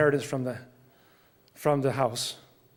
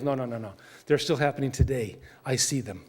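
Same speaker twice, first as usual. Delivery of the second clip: ordinary talk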